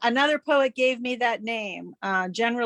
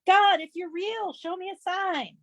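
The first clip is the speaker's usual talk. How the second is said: narrated shout; 8 semitones higher than usual. A narrator's reading. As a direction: call up to God with raised pitch, softening at the end. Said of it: "He shouted to the sky".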